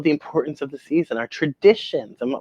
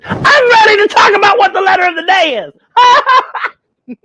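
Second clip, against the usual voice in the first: high, loud gleeful shout; excited announcement; 9 dB louder than usual. Acted. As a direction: burst out with the big reveal. Host's big excited announcement.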